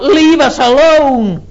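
high, loud, slow outcry; a demon shrieking at its invader; cry out. Acted. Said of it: "And so he cries out".

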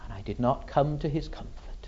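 whispered, quick admission; hushed, barely voiced confession; grave hush. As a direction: drop suddenly to a whisper. Sudden hush after the cry.

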